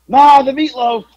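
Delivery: loud, high holler; calling to mom. Pretend. Son calling his mom at dinner time, mock holler. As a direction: yell through the house like an impatient son. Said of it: "My mom's calling me".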